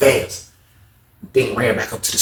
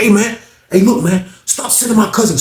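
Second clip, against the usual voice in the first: higher, urgent plea to lay off; high pleading plea; 8 semitones higher than usual. Retold or imitated. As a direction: beg him to stop, voice up high. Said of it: "He was like".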